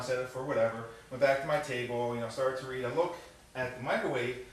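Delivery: normal talking voice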